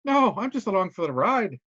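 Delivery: a lightly put-on Irish accent, dismissive; Irish VIP brushing off a reporter, in an Irish accent. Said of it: "I got a shitty accent today".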